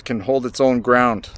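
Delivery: normal talking voice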